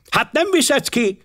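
very high, slow, exasperated outburst; snapping wife. A narrator's reading. Wife to husband wanting his guidebook, snapping. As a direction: flare up in a high, sharp voice. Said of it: "csattant fel Mrs. Clapperton".

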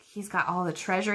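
ordinary delivery

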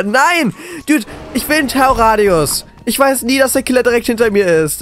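high, exasperated protest; frustrated outcry. Real, not acted. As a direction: cry out at the unfair catch. Caught by killer, complaining.

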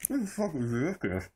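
garbled through a full mouth; cheeks stuffed full. Real, not acted. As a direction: speak round a mouthful.

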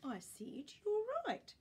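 put-on high pleading old man voice; pleading Grandpa. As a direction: plead to keep the cardigan. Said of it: "said Grandpa".

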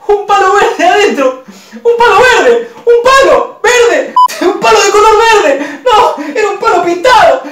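half-laugh, half-cry; laughing despair. Retold or imitated. Finding a painted green stick in the briefcase, laughing in despair. Discovers stick, not money.

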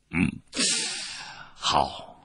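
low broken phrases with pauses; stirred, halting reply. A narrator's reading. Noble tempted by the envoy's offer, halting and stirred. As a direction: answer haltingly, moved by the proposal. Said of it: "激动地 时断时续地回答道".

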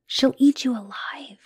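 unvoiced whisper of warning; hushed warning. Girlfriend warning partner about Lilith, whispered. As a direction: whisper it like a dark secret.

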